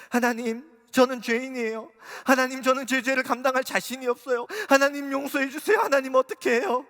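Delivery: loud, high tearful pleading to God; sobbing prayer. Retold or imitated. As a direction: cry out to God through sobs. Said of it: "이렇게 흐느껴 울기 시작했어요".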